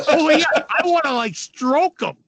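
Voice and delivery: can barely get the words out for laughing; laughing through words. Real, not acted. Recalling an absurd teammate to podcast hosts, laughing hard. Cracking up at the story.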